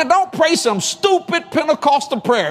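normal talking voice

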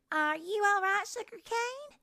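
sweet southern belle. Acted. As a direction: coo over her like a belle. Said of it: "Rosetta asked Tink".

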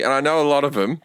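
usual speaking voice

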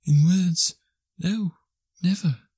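put-on a fair young girl letting her lover go; young woman's voice. A narrator's reading. Belle, young woman voiced high.

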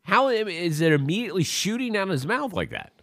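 high-pitched, worked-up disbelief; agitated, incredulous retelling; incredulous rant. Real, not acted. Worked-up rant about gross scene.

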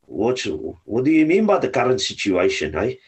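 put-on light South African accent on the guide's reply; a lightly done South African accent, mildly amused; hint of South African accent, answering the client's question. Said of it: "I'm trying to do a South African accent".

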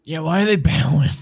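high, put-on vampire voice; decrepit old vampire. Old vampire puzzles over the bowing party, high voice. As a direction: ask it high, as an aged vampire. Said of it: "decrepit looking vampire with a walking stick".

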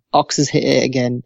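usual speaking voice

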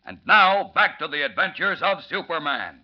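booming show announcer; old radio announcer. Acted. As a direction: announce the return grandly.

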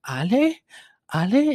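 high, questioning call of the name; imitated mom. Mom knocking at her son's door, imitated worried call. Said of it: "my mom's like".

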